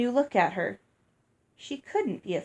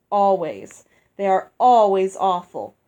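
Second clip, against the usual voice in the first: scornful; 9 dB louder than usual. Acted. Mandy scornful about Lucinda's gifts.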